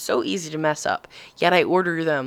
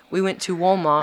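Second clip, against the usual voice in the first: flat American-sounding Walmart; American accent; 2 semitones higher than usual. Shown as a demonstration. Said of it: "like an American".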